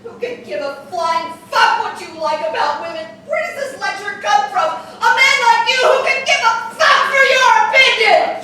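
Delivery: furious yelling. Acted. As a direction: explode at him, all fury. Drunken quarrel, she explodes.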